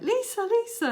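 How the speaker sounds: high, loud call across the house. Retold or imitated. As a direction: shout for Lisa from the other room. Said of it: "So I shouted".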